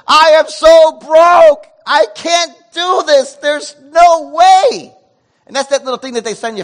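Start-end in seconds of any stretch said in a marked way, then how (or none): 0.1-4.9 louder and higher, slowly dragged out in alarm; panicked outburst. Retold or imitated. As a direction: cry it out like the bill just wrecked you. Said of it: "I was like".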